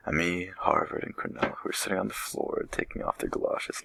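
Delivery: usual speaking voice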